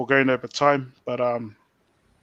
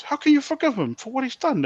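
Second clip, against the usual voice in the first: much higher, disbelieving; a lightly voiced shocked brother-in-law; incredulous question; 11 semitones higher than usual. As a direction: ask it as if it makes no sense. Brother-in-law can't believe the forgiveness.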